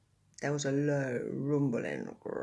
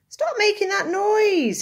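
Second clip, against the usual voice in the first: much higher, louder woken-up yell; angry shout; 9 dB louder than usual. A narrator's reading. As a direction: bellow it from the branch, fed up. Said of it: "he shouted".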